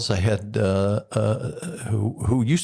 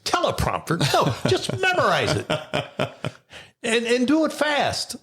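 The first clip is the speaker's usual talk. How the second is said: imitated incredulous partner; 13 semitones higher than usual. Partner refusing the host a teleprompter, incredulous. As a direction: imitate her scoffing at the request in a much higher voice.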